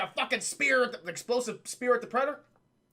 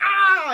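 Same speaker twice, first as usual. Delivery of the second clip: pained scream; 11 semitones higher, 14 dB louder than usual. Retold or imitated. Predator hit by explosion.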